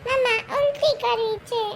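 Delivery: put-on a queasy kid tugging at his mother; sick little boy. Little son tells his mother he must throw up, in a high, slow whine.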